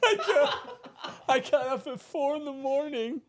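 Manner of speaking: real laughter breaking through the words; laughing while talking. Real, not acted. Telling his co-star the tan story, laughing through it.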